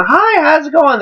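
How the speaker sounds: put-on high, sweet girly greeting; cute girl voice. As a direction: sound like the cute girl at the window. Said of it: "girl coming up going".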